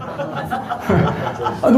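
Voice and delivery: short real laugh at himself; embarrassed laugh. Real, not acted. Pastor laughing at his own imagined reply, soft real chuckle. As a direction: laugh it off, a little sheepish.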